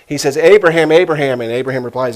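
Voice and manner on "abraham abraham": loud, urgent call from on high; angel calling out. Acted. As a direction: call the name out forcefully, twice. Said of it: "calls out to him from heaven".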